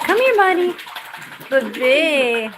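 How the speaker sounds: high, sing-song call to the bird; pet talk. Real, not acted. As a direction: coax the pet over in a high voice.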